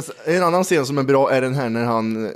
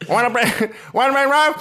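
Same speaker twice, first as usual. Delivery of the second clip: high, nasal-sounding attempt at a gangster line; Tony Montana voice; 9 semitones higher than usual. Shown as a demonstration. Friends dare him to try the movie voice, high and strained. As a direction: tries the American TV voice and lands much higher. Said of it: "Gör, gör det. Testa det".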